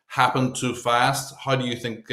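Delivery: ordinary talk, nothing marked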